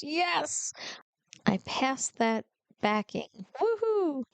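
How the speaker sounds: high, thrilled "yes" and a whooping woohoo; excited cheer. Retold or imitated. Cheering at passing the backing test, high and excited. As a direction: relive the joy of passing the backing. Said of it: "I was so excited".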